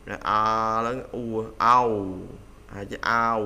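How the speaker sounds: ao said loud and open, lips moving; loud vowel demo. Shown as a demonstration. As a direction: open wide, then close the lips. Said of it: "âm ao". (phát âm ao to, miệng mở rộng rồi thu lại)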